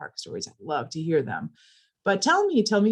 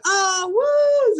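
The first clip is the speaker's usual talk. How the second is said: high, loud delighted cheer; excited whoop; 8 dB louder than usual. Retold or imitated. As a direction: whoop back in joy. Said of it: "And I said".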